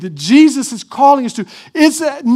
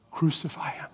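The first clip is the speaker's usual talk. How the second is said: hushed whisper; 8 semitones lower, 16 dB quieter than usual. Retold or imitated. Preacher foreshadows the crowd turning on Jesus, whispered. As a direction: whisper the crowd's cry, low and dark. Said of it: "will be shouting out".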